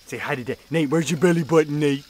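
ordinary talk

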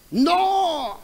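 high, mock desperate cry of no; cry of despair. Preacher acting out a woman crying out in despair. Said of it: "Es un grito de desesperación".

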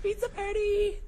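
pizza party squealed in a very high voice; a sudden giddy high-pitched cheer; excited squeal. Real, not acted. Host cheering the promised pizza party, squealing high.

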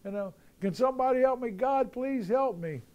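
a man dangling from a tree, begging for rescue, high panicked voice; panicked yelling. Retold or imitated. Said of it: "He starts yelling".

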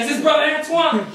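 hammed-up impression of a pestering caller; imitated caller. Stranger phoning the preacher out of the blue, imitated caller. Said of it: "they were talking just like this".

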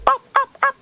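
high, loud excited yips; excited dog yips. Retold or imitated. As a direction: yap like a dog hearing its favourite word. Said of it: "it'd be like".

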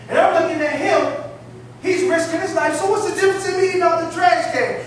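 ordinary talk

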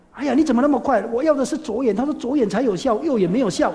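loud, high fussing voice; old woman complaining. Retold or imitated. Old woman scolds the monk for the wrong eye, loud and high. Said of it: "他先讲说".

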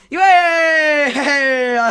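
loud, high drawn-out yay with laughter; triumphant cheer. Real, not acted. Player who found the word, loud laughing cheer.

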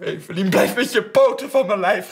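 very high, loud yell; shrieked warning. Retold or imitated. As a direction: scream to be left alone. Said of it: "gilde ze". (gillend, fel van zich af bijtend)

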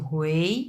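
"হুয়েই" starting low and rising; second tone. Shown as a demonstration. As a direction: Mandarin tone two on "হুয়েই", pitch sliding up. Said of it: "দুই number tone". ("হুয়েই" দুই number টোনে, উঠতি সুরে)

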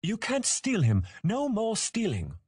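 put-on very high childish scolding; angry youngster. A narrator's reading. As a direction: forbid it like an indignant small child. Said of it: "Tori gasped angrily".

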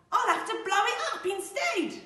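high, loud outburst from the wolf; shouted storybook threat; wolf's threat. Acted. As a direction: explode with villainous menace. Wolf makes a louder threat.

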